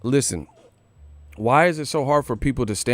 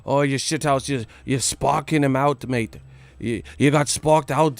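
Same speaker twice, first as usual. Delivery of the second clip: put-on British voice, sneering; mock British accent; 2 semitones higher than usual. Vlogger parodying British fans in a mock British accent. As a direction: jeer it like a cockney lad. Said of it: "we don't talk like that".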